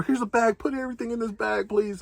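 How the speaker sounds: hurried helpful attendant through the door; flight attendant voice. Retold or imitated.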